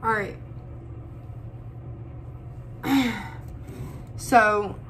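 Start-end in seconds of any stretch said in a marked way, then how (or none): 0.4-4.1 long drawn-out tearful sigh; a sigh to steady herself; long shaky sigh. Real, not acted. Vlogger to viewers, steadying sigh before going on.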